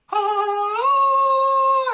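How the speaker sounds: very high, loud held "ah"; orchestra swell. Acted. Game master mimics the orchestra behind you, high blaring swell. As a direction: belt out a sustained orchestral chord. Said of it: "the orchestra behind you goes like".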